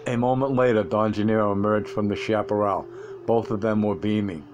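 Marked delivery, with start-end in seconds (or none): none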